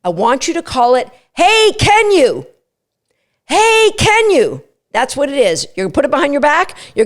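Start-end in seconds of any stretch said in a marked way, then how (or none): 1.3-4.6 high, slow, sing-song "Hey, can you?"; excitable cue. Shown as a demonstration. Trainer demoing the muzzle cue, excitable. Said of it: "say it in a really excitable way like this".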